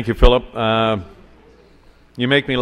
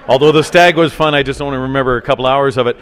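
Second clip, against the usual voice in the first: excited laugh; 3 semitones higher, 9 dB louder than usual. Real, not acted. Laughing recall of stag night.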